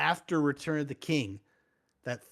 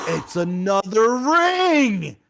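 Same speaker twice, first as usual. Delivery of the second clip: high, loud, stretched-out exclamation; startled shout; 7 semitones higher, 10 dB louder, much slower than usual. Retold or imitated. Frodo finds a ring in the weed bag and shouts high. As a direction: blurt out the discovery in a big, slow shout. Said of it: "He's like".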